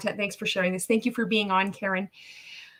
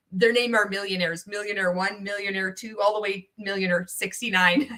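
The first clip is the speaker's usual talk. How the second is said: normal talking voice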